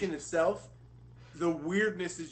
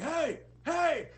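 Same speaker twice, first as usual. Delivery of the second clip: high, loud shout of a stranger calling out; man yelling hey; 12 semitones higher, 4 dB louder than usual. Retold or imitated. Stranger calling at them from the woods, loud high shout. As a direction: yell hey hey across the lot.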